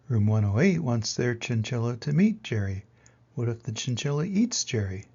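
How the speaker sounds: lively read-aloud storytelling voice; story narration. A narrator's reading. Teacher reading a picture book to kids, lively storytelling.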